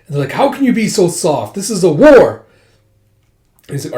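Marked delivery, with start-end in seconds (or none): 0.3-2.5 loud, high acted outburst; indignant protest. Soldiers objecting to mercy in war, indignant shout. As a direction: protest hotly, building to a shout.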